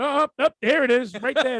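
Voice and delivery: loud, high gloating reveal; a retold gotcha moment, played loud; mock triumphant. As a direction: act out proudly finding the paperwork. Acting out finding the paper.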